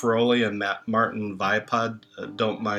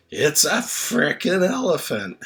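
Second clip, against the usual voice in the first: higher, cheeky schoolboy voice repeating it stubbornly; mock childish insistence; little Johnny again; 5 semitones higher than usual. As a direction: play the kid insisting on his answer. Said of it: "Same words, different emphasis".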